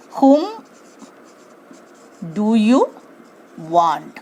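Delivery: whom stressed, spaced out correct question; question dictated with pauses. Shown as a demonstration. Teacher dictates the correct form, word by word. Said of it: "So the correct form".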